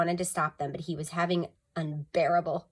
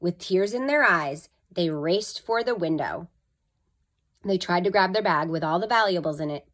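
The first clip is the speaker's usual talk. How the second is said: plain reading voice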